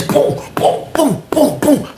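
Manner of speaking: hoge stemloze klapgeluiden; punch sounds. Retold or imitated. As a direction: doe de stoten na met je mond.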